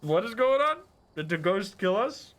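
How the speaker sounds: high, loud startled cry; what just happened, startled and loud; startled outburst. Real, not acted. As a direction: caught off guard by the freeze. Sudden game glitch, startled.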